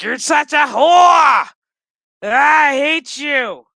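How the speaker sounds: slow, screaming at the top of her lungs, high and loud; furious shout. Retold or imitated. Agnes screaming hatred at the Borg Queen, a furious slow shout. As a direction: hurl the insult like a tantrum.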